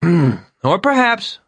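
high and very slow, drawn out; pompous Vern. Acted. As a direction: stretch it out grandly after a throat clear. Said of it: "Vern cleared his throat".

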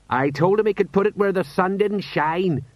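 high, put-on exclaiming voice; agitated sergeant. Sergeant recounts the insult crossing the bridge, agitated. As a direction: blurt it high, amazed at the nerve.

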